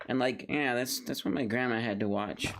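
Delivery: normal talking voice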